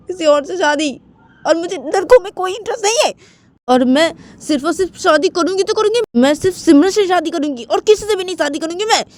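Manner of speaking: high-pitched, agitated daughter's protest; exasperated daughter. Acted. Daughter refusing the marriage Papa plans, worked up and defiant. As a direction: argue back at Papa, pitch climbing high.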